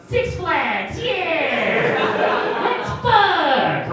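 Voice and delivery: high, loud hammed-up announcer voice; mock dating show host. Comic imitating a dating show host to the audience, hammed-up announcer.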